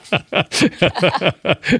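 high, rolling laugh; hearty laughter. Real, not acted. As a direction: burst out laughing at the interruption. Dog interruption breaks up the storyteller.

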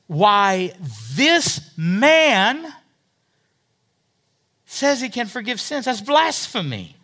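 höher und lauter, empört: Why, this man says he can forgive sins; indignant scribes. Acted. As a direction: als entrüsteter Schriftgelehrter, höher und lauter. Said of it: "They say".

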